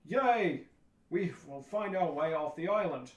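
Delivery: hammy heroic players celebrating; cheering stage heroes. Acted. Heroes answer the freed tribe on stage, hammed-up cheer. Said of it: "And the heroes go".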